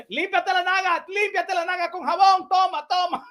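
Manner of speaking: high mock impression in Spanish; hammed-up handout patter on the edge of laughing; Donald Trump. Handing out paper towels in Spanish, mock Trump. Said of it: "Donald Trump was like this".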